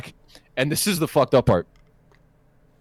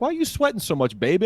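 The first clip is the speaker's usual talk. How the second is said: high-pitched, cooing woman's voice; concerned woman; 8 semitones higher, faster than usual. Retold or imitated. Woman drawing blood from a sweating patient, high concerned voice. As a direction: lift it way up for her question.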